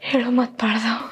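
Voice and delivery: weak whisper. Acted. Dying wife's last words.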